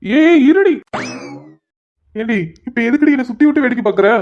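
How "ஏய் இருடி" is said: higher, louder startled yelp; loud flustered protest; alarmed shout. Acted. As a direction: cry out to make her stop. Being spun round by wife.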